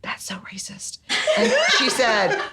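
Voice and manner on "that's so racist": scandalised stage whisper; hushed, retold reproach with barely any voice; whispered accusation. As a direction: whisper it under your breath. Whispered aside in retold quarrel.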